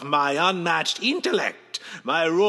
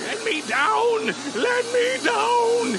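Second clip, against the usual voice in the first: terrified shrieking; 10 semitones higher than usual. Acted. Villain carried off by his own flock, terrified shrieks. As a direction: shriek for rescue from the birds.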